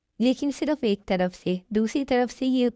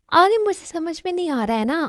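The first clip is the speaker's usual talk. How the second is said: whiny complaint; 7 semitones higher than usual. Acted. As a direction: complain like a child who feels picked on. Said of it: "आप भी डांट रही हैं".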